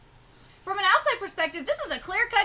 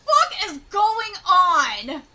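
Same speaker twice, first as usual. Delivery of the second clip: loud, drawn-out outraged yell; slow baffled yell; 4 dB louder, slower than usual. Retold or imitated. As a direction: stretch out the shock. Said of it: "She's like".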